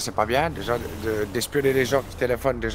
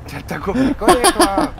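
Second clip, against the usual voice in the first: incredulous shout; 7 semitones higher, 8 dB louder, slower than usual. Acted. Incredulous outburst at brother.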